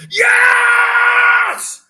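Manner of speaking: sudden held high shriek of joy; real loud jubilation; ecstatic scream. Real, not acted. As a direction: erupt into a long victory yell. Breaks off mid-sentence to celebrate.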